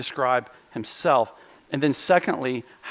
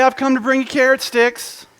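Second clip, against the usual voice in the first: laughing through the silly misquote; laughing mock quote; 10 semitones higher, 5 dB louder than usual. Pastor joking to the congregation about Jesus, laughing. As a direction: tease it with a laugh.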